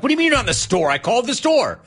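exasperated disbelief. Retold or imitated. Retold exasperated reply to clerk.

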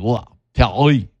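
hammed-up French "Pelly"; French accent. Shown as a demonstration. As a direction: say "Wah, Pelly" in mock French. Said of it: "Like that, right".

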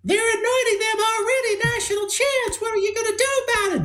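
very high squeaky coach impression; Barry's high-pitched voice. Radio host mimicking the old coach, high-pitched squawk. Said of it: "you could just hear that high-pitched voice".

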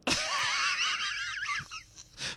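high squealing burst of laughter; shrieking laugh. Real, not acted. Host shrieking with laughter at the co-host's joke.

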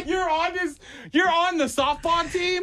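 high-pitched laughing disbelief; incredulous laughing. Real, not acted. Host learns coworker is on the team, laughing in disbelief. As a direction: squeal the question through real laughter. Astonished by teammate's confession, laughing.

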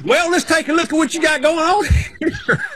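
hammed-up Southern accent, a folksy surgeon sizing up the patient. Retelling Jeff Foxworthy's bit, a surgeon greets the patient in an exaggerated Southern accent. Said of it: "he understands about the Southern accent".

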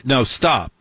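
usual speaking voice

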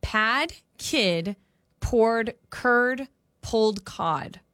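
slow careful reading. Shown as a demonstration. Co-host slowly reading the twister aloud for him to try. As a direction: slowly sound out each word. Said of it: "reads the tongue twister".

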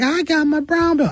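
put-on high, boastful kid voice; bragging little kid. Comedian mimicking karate kids bragging, high childlike. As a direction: brag like a proud seven-year-old.

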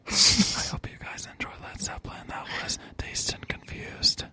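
whispering right into the mic; breathy close-mic whisper, almost silent; ASMR whisper. Real, not acted. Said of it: "I'm gonna whisper into the microphone".

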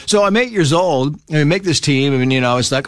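usual speaking voice

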